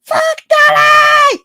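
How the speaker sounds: very high, slow shrieked insult; screaming bees. Pretend. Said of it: "When the bees start screaming".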